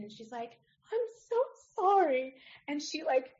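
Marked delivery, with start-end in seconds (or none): 0.9-2.3 high, tearful apology; a young doctor about to cry; much higher, weepy "so sorry"; near tears. Retold or imitated. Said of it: "kind of starts to cry".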